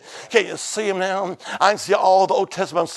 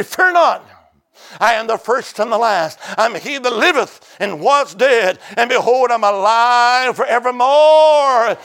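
triumphant preaching; 4 semitones higher, 7 dB louder than usual. Retold or imitated. Preacher quoting the risen Christ to John, triumphant proclamation. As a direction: open on a high, loud cry, then proclaim.

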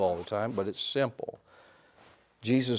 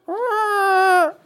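much higher squealing hum; mock baby elephant trumpet; 9 dB louder than usual. Preacher imitates the baby elephant hiding, high squeal. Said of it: "raise his trunk and go".

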